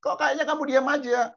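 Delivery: high, drawn-out mock cries to God; hammed-up wailing prayer; wailing complaint. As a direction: plead loudly like a desperate whiner. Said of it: "Jangan kita teriak-teriak".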